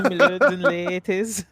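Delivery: laughing imitation of the butler line; teasing the GM's accent attempt; mocking laugh. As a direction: giggly parody of the butler greeting. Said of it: "you're committed to this".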